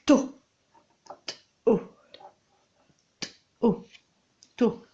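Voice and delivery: "To" said again and again, isolated; "To" given alone, a beat at a time; repeated syllable. Shown as a demonstration. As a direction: hammer on the single syllable "To". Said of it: "Qu'est-ce que tu entends dans le son".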